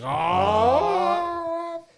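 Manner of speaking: a sudden high wailing "Oh!" like breaking into tears; mock crying yelp; mock sob cry. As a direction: burst out in a hammed-up sob. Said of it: "I'm like".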